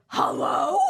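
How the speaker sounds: loud, high, incredulous greeting; hammed-up indignant bark; outraged. Junior doctor to late-calling attending, outraged. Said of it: "I'm like".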